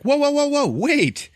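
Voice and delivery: high, loud panicked shout; mock panic, voice shooting up; alarmed pleading. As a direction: cry out to stop him at once. Mummy alarmed as ruby cracks.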